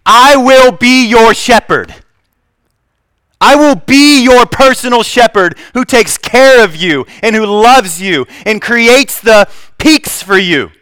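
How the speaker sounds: high, loud and slow, proclaiming as God himself. Acted. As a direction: boom it out like God speaking to his flock. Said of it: "This is the God of the universe saying".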